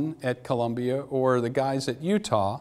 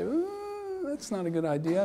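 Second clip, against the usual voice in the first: a high squeak of alarm on ooh, then a softer recoil; 13 semitones higher, 5 dB quieter than usual. Pretend. Patient hearing about brain nails, alarmed high ooh. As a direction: recoil as if told something horrifying. Imagined patient hears surgery plan.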